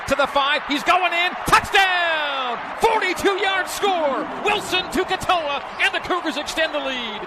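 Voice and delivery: high shouting play-by-play; touchdown call. Real, not acted. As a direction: yell the touchdown home. Replayed radio touchdown call.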